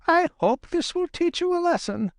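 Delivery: put-on high, sharp scolding old fox; scolding Granny Fox. A narrator's reading.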